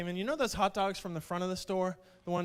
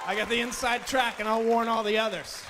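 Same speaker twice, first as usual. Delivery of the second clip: laughing loud; 5 semitones higher, 6 dB louder than usual. Real, not acted. Punchline after laughing.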